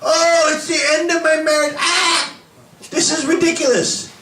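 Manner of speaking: high, wailing mock lament; mock despair. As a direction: ham up a despairing spouse. Mocking a despairing believer.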